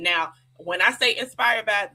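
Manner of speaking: usual speaking voice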